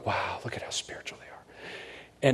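hushed, breathless whisper of fake awe; mock awed whisper. The crowd marveling at the showy priests, mock awed whisper. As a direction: whisper it like a gawking crowd member.

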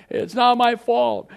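high, loud, drawn-out denial; hammed-up disowning of blame; mock protest. Mimics culture dodging blame.

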